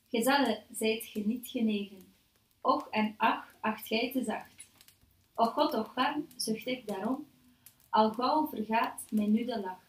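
slow, measured poetry recital; poem reading. Acted. Host reads the poem aloud to the panel, slow measured recital. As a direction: recite the verse deliberately, phrase by phrase.

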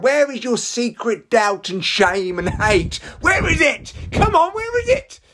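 mock demanding frenzy. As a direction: interrogate them like a hammy detective. Challenging fearless comedians, mock frenzy.

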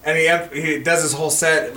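ordinary talk

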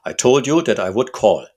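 put-on loud, harsh German-accented visitor; strongly marked German accent, loud and harsh. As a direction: bark it in a heavy German accent. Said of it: "strongly marked German accent".

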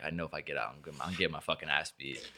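low, muttered thoughts to himself; hushed inner thought. Retold or imitated. Scared kid muttering to himself in the car, hushed. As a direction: mutter it under your breath, scared.

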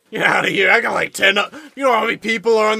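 loud exasperated yell; shouting. Acted. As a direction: bark it out, fed up. Cook yelling at crew.

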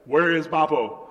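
loud, frantic retold demand; panicked dad. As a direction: act out a father freaking out. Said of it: "He's freaking out".

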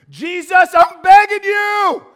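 shouted high, pleading cry to Jesus; desperate begging prayer. Acted. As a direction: beg at the top of your voice.

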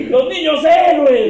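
loud, slow, laughing goal call; frantic mock sports commentary. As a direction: belt it like a stadium commentator.

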